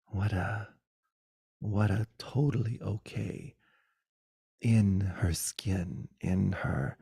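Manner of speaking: soft, slow, half-whispered wonder; hushed awe. Real, not acted. Recalling Maya Angelou's presence in a soft, slow, hushed voice. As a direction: breathe it out in awe.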